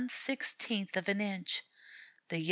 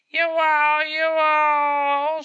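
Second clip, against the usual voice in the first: very loud, high, long yowls; screaming cat; 9 semitones higher, 10 dB louder, much slower than usual. A narrator's reading. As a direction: scream it like a tomcat.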